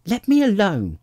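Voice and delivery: high, loud demand to be left alone; cross child's protest; indignant. A narrator's reading. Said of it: "said Alice indignantly".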